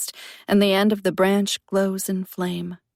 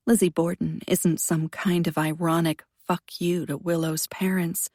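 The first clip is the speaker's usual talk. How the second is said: plain reading voice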